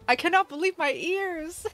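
over-the-top pretend amazement; mock shock. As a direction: play it wide-eyed and stunned. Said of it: "Prepare a shocked face".

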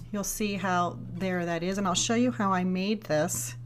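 usual speaking voice

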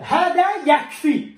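slow, firm and loud on هذا; firm teaching read. Shown as a demonstration. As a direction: present the phrase هذا يكفي firmly. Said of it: "آخر جملة". (هذا يكفي بنبرة حازمة)

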